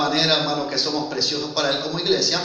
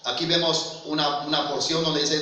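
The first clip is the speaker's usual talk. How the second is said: ordinary talk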